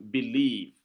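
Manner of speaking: believe with a light first syllable and a held -lieve; stress on second syllable. Shown as a demonstration. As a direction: lean on the end of believe, be-LIEVE. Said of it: "it means a stressed syllable followed by".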